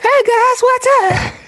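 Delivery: very high, loud squeaky voice; alternative creep voice. Shown as a demonstration. Host shows his own way of greeting, in falsetto. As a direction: shoot way up into a silly falsetto. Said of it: "But I would talk more like".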